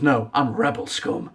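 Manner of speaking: a touch of a defiant Finn comeback; proud hero retort. Retold or imitated. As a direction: toss it back with pride. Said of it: "and he goes".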